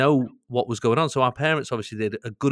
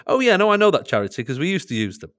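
imitating mum's breezy admission, higher and louder; matter-of-fact; 5 semitones higher, 5 dB louder than usual. Son retells mum's charity revelation, imitated matter-of-fact tone. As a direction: toss it off as utterly normal. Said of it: "like it was the most normal thing in the world".

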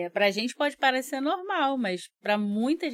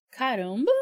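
surprised caramba; 3 dB louder than usual. Shown as a demonstration. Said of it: "pensar na palavra caramba".

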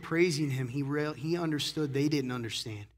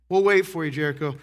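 teasing call out; 8 dB louder than usual. Real, not acted. Teasing calling out to a kid.

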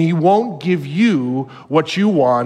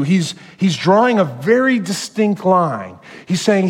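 ordinary delivery

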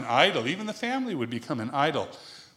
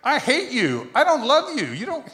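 a hammed-up rejecting parent, raised pitch; mock cruel father; 6 semitones higher, 4 dB louder than usual. Father mock-scolding the wife learning to ride. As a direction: pretend to scold a child. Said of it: "I didn't say".

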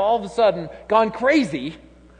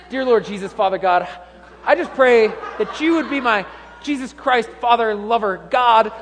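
mock fervent prayer; 5 semitones higher, 6 dB louder than usual. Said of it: "you get to hear multiple, multiple names of God".

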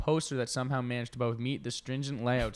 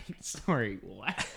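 laughing confusion; slower than usual. Retold or imitated. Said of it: "everyone's like".

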